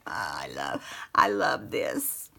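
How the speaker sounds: slow whispered admiration. Real, not acted. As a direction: breathe it out in awe. Admiring fabric softly.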